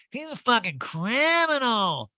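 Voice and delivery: very high, slow mock outrage; hammed-up indignant impersonation; Erica. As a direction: draw out the accusation in a swooping caricature. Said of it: "And Erica's like".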